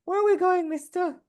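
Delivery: put-on high, eager little girl voice; wholesome 1950s kid. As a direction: ask it like a thrilled small kid. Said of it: "is like".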